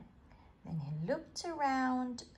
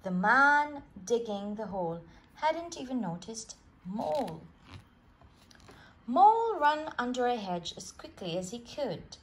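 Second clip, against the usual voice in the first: ordinary delivery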